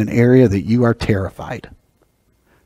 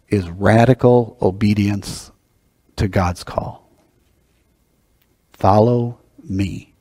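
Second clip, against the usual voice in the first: slow, weighty emphasis; 3 semitones lower, 4 dB quieter, slower than usual. Real, not acted. To the congregation, the preacher slows right down for emphasis. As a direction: drop the pace and let each word land. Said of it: "preacher slows for emphasis".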